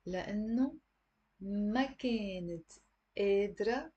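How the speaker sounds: slow, word by word with pauses; slow reading. Shown as a demonstration. Teacher reading slowly for students to repeat. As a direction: read it slowly, one word at a time. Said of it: "I'm gonna read slowly again".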